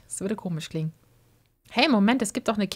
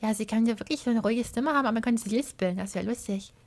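ordinary talk

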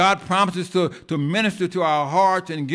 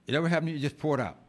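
ordinary delivery

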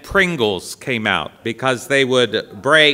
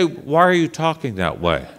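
normal talking voice

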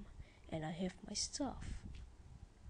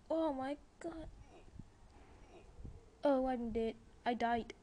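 loud, higher-pitched cry of dismay; shocked outcry; 6 semitones higher, 4 dB louder than usual. Real, not acted. Gamer to viewers on dying in the game, shocked outcry. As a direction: blurt it out as disaster hits. Character just died in game.

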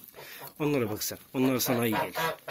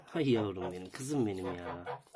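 tender; 3 semitones lower, 7 dB quieter, slower than usual. Real, not acted. Talking tenderly to the goose.

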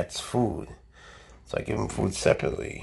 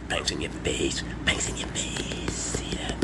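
whisper; 9 semitones lower, 6 dB quieter, slower than usual. Shown as a demonstration. Repeating the cobra line whispered.

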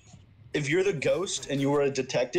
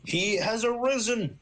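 slow, high, grandiose proclamation; mock prophet; 6 semitones higher, much slower than usual. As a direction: announce it like a prophet. Mock-solemn proclamation of a return.

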